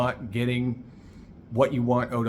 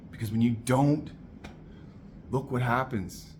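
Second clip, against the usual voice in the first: ordinary delivery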